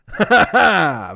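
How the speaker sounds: real hooting laughter; shouting laugh. Real, not acted. Laughing shout after punchline.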